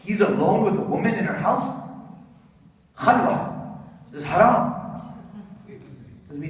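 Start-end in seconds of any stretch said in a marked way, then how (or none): 0.0-4.7 hammed-up pious shock, with Khalwa breathed out and haram pushed louder; clutch-your-pearls mock horror at the word haram; mock scandalized outrage. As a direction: mimic a scandalized judge. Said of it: "we would hear the story and say".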